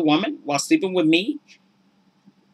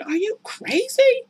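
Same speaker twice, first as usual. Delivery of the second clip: mock scream. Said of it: "she starts screaming".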